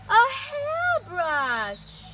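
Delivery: high, loud, very slow reveal. Shown as a demonstration. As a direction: draw out the surprise for the kids. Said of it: "what do you think it was?".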